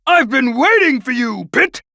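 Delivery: slow theatrical villain menace. Retold or imitated. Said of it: "I'd expect a boss or something, and he'd be like".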